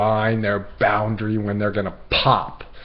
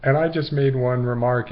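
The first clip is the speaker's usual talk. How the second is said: normal talking voice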